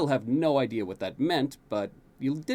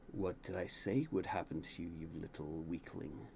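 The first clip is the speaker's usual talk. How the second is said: much lower, softer sneering taunt; gloating villain; 7 semitones lower, 11 dB quieter than usual. A narrator's reading. Jaden taunting the fallen prince, low gloating sneer. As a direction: savour the cruelty. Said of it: "Jaden grins devilishly".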